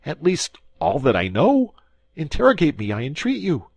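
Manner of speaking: high, loud desperate begging; terrified little man pleading for mercy; pleading prisoner. A narrator's reading. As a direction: beg the commissary in a high panicky voice. Bonacieux pleading with commissary.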